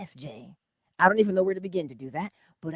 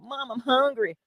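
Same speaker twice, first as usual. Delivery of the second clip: put-on higher, louder kid calling Mom; whining child; 7 semitones higher, 5 dB louder than usual. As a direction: imitate your child pestering for food. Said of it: "my child will break down my door".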